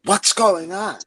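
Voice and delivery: called out high and loud; yelling to neighbors. Retold or imitated. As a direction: shout across to the neighbors' deck. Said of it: "I yell over to my neighbors".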